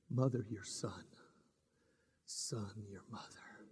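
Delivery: soft, low, fading words; tender hush. Acted. Jesus from the cross to his mother and disciple, tender hush. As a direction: barely voice it as strength runs out.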